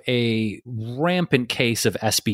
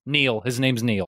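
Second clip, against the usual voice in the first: ordinary talk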